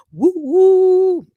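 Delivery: high, loud whooping cheer. Real, not acted. Cheering for son's birthday.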